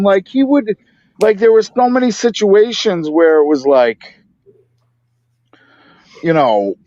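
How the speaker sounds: ordinary talk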